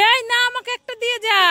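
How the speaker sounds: high, hurried protest; quick high-pitched objection; protesting plea. Acted. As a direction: call after the child to hand one over. Mother protests as child takes both.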